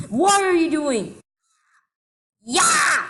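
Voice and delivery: ordinary delivery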